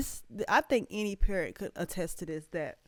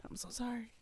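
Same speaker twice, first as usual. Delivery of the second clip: tearful near-whisper apology; whispered through tears, overcome by the song; choked up; 2 semitones higher, 13 dB quieter than usual. Real, not acted. Emotional vlogger whispers sorry to her viewers, choked up. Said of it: "I'm getting emotional".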